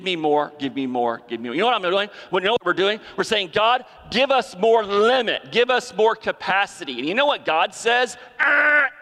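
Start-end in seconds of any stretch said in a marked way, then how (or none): 3.5-7.0 higher, louder insistent plea; demanding prayer. Retold or imitated. Congregation's greedy prayer to God, loud and insistent. 8.4-8.9 sharp, high, loud ah cutting off the request; God's dismissive ah. Retold or imitated. God answering the greedy prayer with a loud curt ah. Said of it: "That's how he says it".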